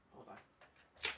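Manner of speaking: whispered. Real, not acted. Squinting to read chat.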